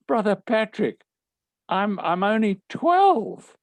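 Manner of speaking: high, drawn-out mock dismay; hammed-up high-voiced objection; boyish protest. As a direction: plead like an astonished schoolboy. Boy protesting to his teacher.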